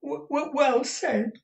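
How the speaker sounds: high, slow, stammered approval; very high halting voice; Smike. A narrator's reading. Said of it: "cried Smike".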